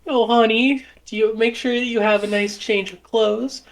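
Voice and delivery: high-pitched put-on mom voice; high, coddling mother act; doting fussy mother. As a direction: fuss over your child in a high voice. Plays the mother.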